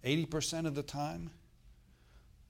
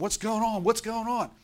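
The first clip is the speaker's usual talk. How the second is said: higher, louder mock-anxious repeat; mock anxious fretting; 9 semitones higher, 5 dB louder than usual. Pastor mocking people anxious about the government, higher and louder. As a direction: fret like a worried news-watcher, hammed-up.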